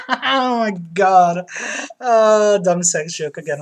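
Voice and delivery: ordinary talk, nothing marked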